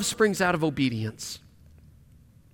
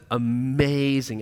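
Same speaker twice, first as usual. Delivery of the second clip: mock amazement; 7 semitones lower, 5 dB quieter, much slower than usual. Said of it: "God looks down on the world and says".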